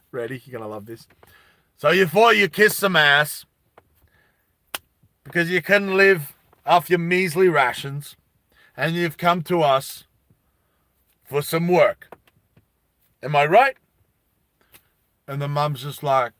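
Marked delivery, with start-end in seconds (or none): 1.8-13.8 loud, cocky synth foreman; punchy taunts in separate bursts; brash foreman. Acted. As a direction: play a mouthy boss needling the new workers.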